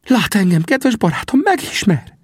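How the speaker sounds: high, eager questioning; excited Sam. A narrator's reading. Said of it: "kérdezte izgatottan".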